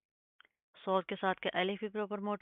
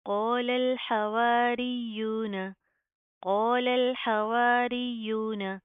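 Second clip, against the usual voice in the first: slow chanted tajweed, heavy qaaf; Quranic recitation; 3 semitones higher, 4 dB louder, much slower than usual. Shown as a demonstration. Teacher recites the verse for students to copy. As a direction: chant क़ालल slowly with a thick qaaf. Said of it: "simple किस तरह पढ़ेंगे".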